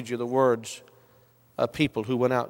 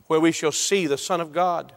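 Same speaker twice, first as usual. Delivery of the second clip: ordinary delivery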